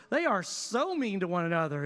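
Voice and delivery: usual speaking voice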